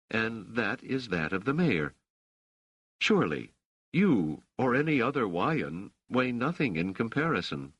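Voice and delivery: a hint of cool verbal sparring; lightly voiced challenging Dors. A narrator's reading. Dors disputes Rochelle's claim of victory, lightly voiced challenge.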